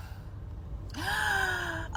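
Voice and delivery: soft, high drawn-in gasp; delighted gasp. Acted. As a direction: gasp softly at something adorable. Acted delight at seeing a puppy.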